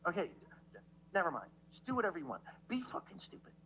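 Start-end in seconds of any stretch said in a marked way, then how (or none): 2.7-3.5 under-breath jab; whispered insult. Real, not acted. Giving up on the chat, whispering an insult. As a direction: hiss it under your breath.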